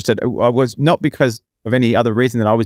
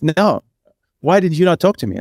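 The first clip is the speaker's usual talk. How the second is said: higher, imitated Brazilian accent; 7 semitones higher than usual. Woman asks why he ignored her, mock Brazilian accent, higher. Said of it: "with, with the accent".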